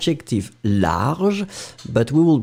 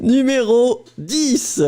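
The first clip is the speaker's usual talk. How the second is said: high, slow, hammed-up French teacher; 10 semitones higher, slower than usual. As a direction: draw out Numéro dix like a teacher. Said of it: "you literally sound like my French teacher".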